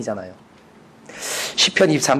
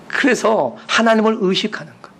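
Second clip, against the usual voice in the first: usual speaking voice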